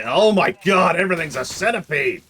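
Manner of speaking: loud, high cry of alarm; real shocked exclamation; startled outburst. Real, not acted. Startled by enemies in game.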